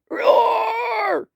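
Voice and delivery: high, shrieking dinosaur roar; long high-pitched roar; loudest roar. A narrator's reading. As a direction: roar as a fierce little dinosaur. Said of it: "He roared his loudest roar".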